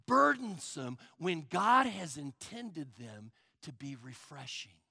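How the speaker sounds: ordinary delivery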